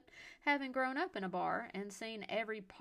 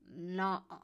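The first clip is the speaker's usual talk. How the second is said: loud, slurred tipsy refusal; garbled drunk; 7 dB louder than usual. A narrator's reading. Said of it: "he garbled again".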